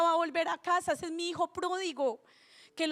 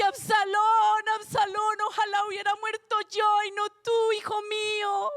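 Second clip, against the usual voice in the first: acted father's grief, high and wailing; lamento lloroso actuado; 4 semitones higher, 4 dB louder than usual. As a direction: cry out in mock mourning, pitched well above normal speech. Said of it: "y llora". (clamor actuado de padre que llora)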